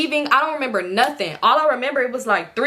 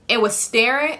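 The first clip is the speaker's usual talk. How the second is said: usual speaking voice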